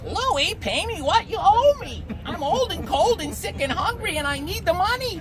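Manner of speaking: put-on high, frail old widow voice; old widow begging for her rent, high voice; little old lady voice. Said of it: "in a little old lady voice".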